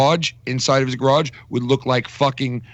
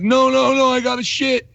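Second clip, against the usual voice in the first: desperate thief; 12 semitones higher, 3 dB louder than usual. Retold or imitated. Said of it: "And he's like".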